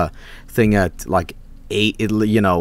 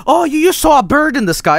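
mocking parents. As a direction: mimic the parents brushing it off. Parents dismissing the child.

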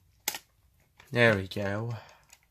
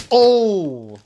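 shocked cry; 9 semitones higher, 7 dB louder than usual. Real, not acted. Dismayed at a big game hit.

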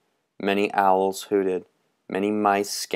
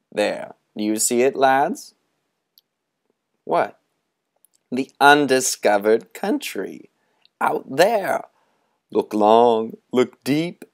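high, looming, theatrical beckoning; sinister old storyteller; 4 semitones higher, 4 dB louder than usual. Acted. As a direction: lure the boys toward the dark. Moundshroud tempts the boys.

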